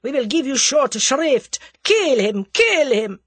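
loud, high incitement to kill; screaming. A narrator's reading. Boris urges the gang on, screaming. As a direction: rouse the mob with fury. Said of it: "he screamed".

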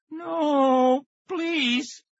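very high, shrill-free frightened cry; terrified scream. A narrator's reading. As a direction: scream for mercy like a small boy. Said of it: "Casey screamed".

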